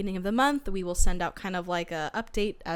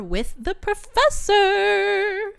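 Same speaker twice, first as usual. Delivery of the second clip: dramatic slowdown; 12 semitones higher, 5 dB louder, much slower than usual. Real, not acted. Dramatic buildup to sparring.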